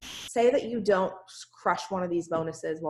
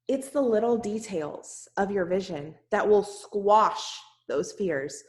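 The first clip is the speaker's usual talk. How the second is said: usual speaking voice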